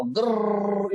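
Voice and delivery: rolled drilling whir, held low; imitated drill noise. Teacher showing students a drill at work, imitated drill noise. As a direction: make the sound of a running drill.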